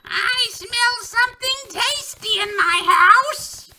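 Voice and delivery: very high, slow, squeaky witch voice. Acted. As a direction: sniff out dinner like a witch. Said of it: "hear a high, squeaky voice say".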